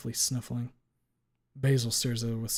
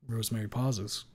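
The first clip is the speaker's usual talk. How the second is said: plain reading voice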